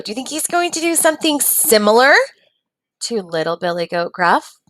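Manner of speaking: ordinary delivery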